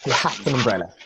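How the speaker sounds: "He has an umbrella" run together as "he ha-za-numbrella"; fuse "has an umbrella" without gaps; connected, flowing "He has an umbrella"; linked sounds. Shown as a demonstration. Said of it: "it's like linking them".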